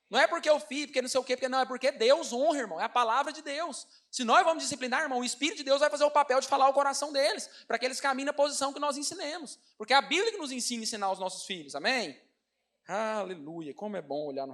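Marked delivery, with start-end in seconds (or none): 0.1-10.8 louder, raised preaching voice. Acted. Pastor preaching to the congregation about discipline, in a raised preaching voice. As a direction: drive it home to the church.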